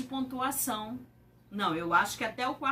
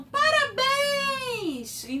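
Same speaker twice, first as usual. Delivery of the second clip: much higher, slow, crying out; joyful congratulations; 12 semitones higher, 4 dB louder, much slower than usual. Shown as a demonstration. Teacher acting out a happy exclamation, high and slow. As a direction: cheer it out gladly. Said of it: "Ou então".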